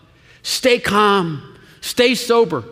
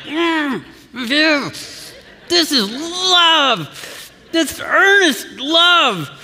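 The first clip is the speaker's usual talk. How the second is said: high, slow, strained crying voice on "this is love"; strained earnest love; 9 semitones higher, much slower than usual. Retold or imitated.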